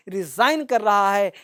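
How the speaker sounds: ordinary talk, nothing marked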